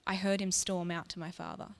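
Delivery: usual speaking voice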